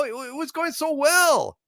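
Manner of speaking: high, wistful complaint; mock lament. [name] complaining to a viewer that the night went wrong, high and wistful.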